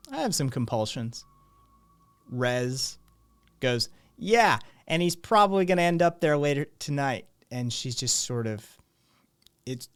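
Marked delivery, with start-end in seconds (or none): 4.2-7.3 fast, loud, higher teasing dig; teasing jab. Acted. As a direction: toss off the jab quickly. GM voices Rez jibing.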